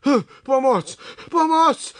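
high, loud cries for help; calling for help. A narrator's reading. Čmelda fallen into the pool, calling for help. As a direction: cry out as if being pulled under.

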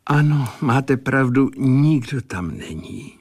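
nikdo stressed with a falling tune; a touch of brooding in nikdo tam není; pensive. A narrator's reading. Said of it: "řekl Sazeč zádumčivě".

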